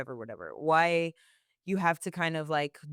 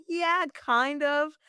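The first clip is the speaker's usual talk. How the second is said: wry agreement; 9 semitones higher, 4 dB louder, much slower than usual. Retold or imitated. Sarcastic reply to easy gig.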